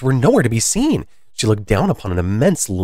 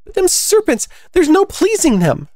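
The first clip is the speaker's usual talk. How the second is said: very high, fretful bird character; high-pitched storybook exasperation; exasperated pigeon; 4 dB louder than usual. Acted. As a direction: complain in a piercingly high pigeon voice. Pigeon complains about serpents.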